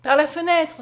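loud, high call; urgent cry. A narrator's reading. Mother crying out to Bastien, loud and urgent. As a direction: point him to the window. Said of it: "s'écria Julienne".

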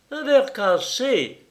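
high, slow dismayed cry; startled exclamation. Acted. Lord Évreux seeing the broken glass, startled cry. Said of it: "s'écria lord Évreux".

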